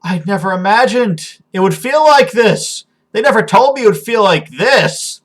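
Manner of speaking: high, impassioned exclamation; overwhelmed amazement. Acted. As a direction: cry it out in astonished rapture. Character overwhelmed by new feeling.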